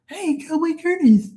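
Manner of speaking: put-on very high squeaky man-child greeting, squealed laugh after; Pee-wee Herman impression. Host greeting his cowboy friend, squeaky mocking impression. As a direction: squeal hello like a cartoonish kids' show host. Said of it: "I used to do Pee Wee's voice all the time".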